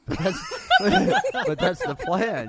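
laughing through the words, high and loud; can't hold the laugh back on the punchline. Real, not acted. Owner cracking up retelling the theft, laughing hard.

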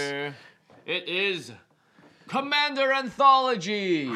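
high, slow announcer reveal; dramatic reveal. Acted. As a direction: unveil it like a game-show prize.

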